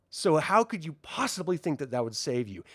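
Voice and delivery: usual speaking voice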